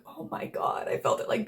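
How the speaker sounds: breathless whispered oh my God; a hushed, winded reaction; stunned whisper. Retold or imitated. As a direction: gasp it out under your breath, heartbroken. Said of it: "I was like".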